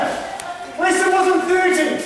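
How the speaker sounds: slow shouted complaint; raging gamer. Real, not acted. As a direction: yell it out slowly. Shouted during game.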